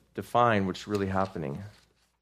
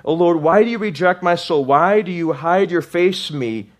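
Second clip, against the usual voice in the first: loud lament; 7 semitones higher, 7 dB louder than usual. Acted. Psalm lament read louder.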